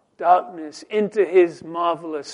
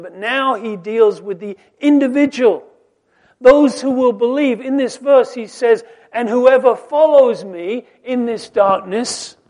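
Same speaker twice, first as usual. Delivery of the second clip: raised, higher voice, pressing each question; preaching at full force; 7 semitones higher, 11 dB louder than usual. Acted. Preacher to his congregation on man's blindness, full-force preaching. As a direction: preach it out, rising with every question. Preacher proclaims Jesus' promise.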